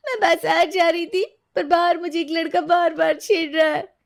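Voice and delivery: high, tearful complaint; fake crying. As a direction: whimper your story to the grown-ups, pretending to cry. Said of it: "झूठ मूठ रोती हुई". (रोनी आवाज़ में झूठी शिकायत)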